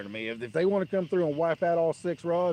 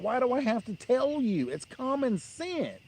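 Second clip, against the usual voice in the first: much higher, slow, incredulous; exasperated retort; 11 semitones higher, slower than usual. Retold or imitated. Arguing with the neighbor over my parking spot, high and incredulous. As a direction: stretch it out, baffled anyone needs telling.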